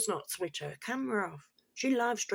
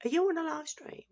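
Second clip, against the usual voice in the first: put-on child voice; 11 semitones higher than usual. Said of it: "and the kid's like".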